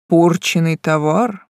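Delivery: slow, eerily calm question; menacing calm, holding back rage; unnaturally calm. A narrator's reading. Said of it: "неестественно спокойно переспросил".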